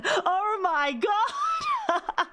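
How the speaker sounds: high, slow laughing cry of disbelief; real giddy laughter. Real, not acted. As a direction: laugh it out overwhelmed.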